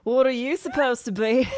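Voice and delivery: loud mock Australian drow; Australian accent. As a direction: ham up an Aussie accent. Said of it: "supposed to have Australian accents".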